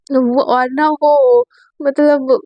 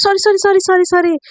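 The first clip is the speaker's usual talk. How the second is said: higher, quick string of sorries; hurried apologies; 5 semitones higher than usual. Acted. Sameer apologising after bumping into Sakshi, higher. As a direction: apologise fast and flustered. Boy bumps into her.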